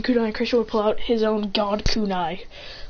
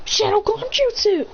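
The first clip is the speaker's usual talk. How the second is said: high, drawn-out anime cry; technique call; 11 semitones higher than usual. Acted. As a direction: announce the move in a high, stretched voice. Calling out a technique.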